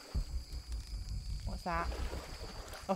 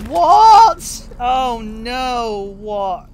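shocked outburst; 15 dB louder, much slower than usual. Real, not acted. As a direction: cry out in loud disbelief. Shocked reaction to the game.

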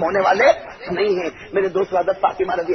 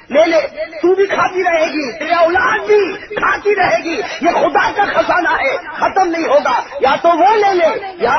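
soaring sermon climax; 9 semitones higher, 3 dB louder than usual. Acted. Preacher to the crowd, rising ecstatic cry. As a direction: carry the crowd to rapture.

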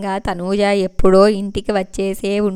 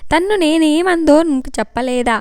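angry; 6 semitones higher than usual. A narrator's reading. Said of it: "Vamsi కూడా కోపంగా అడిగాడు".

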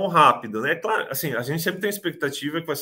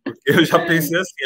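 laughing through the words, a bit louder; 4 semitones higher, 6 dB louder than usual. Real, not acted. Applicant telling the host about suspecting a prank, laughing. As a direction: chuckle as you admit your suspicion. Laughing while recalling disbelief.

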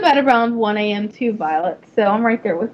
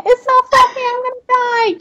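gushing; 12 semitones higher than usual. Real, not acted. Gushing over fluffy horse.

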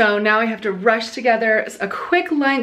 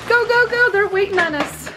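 high quick urging; urgent; 8 semitones higher than usual. Real, not acted. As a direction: hurry them along. Urging helpers to hurry.

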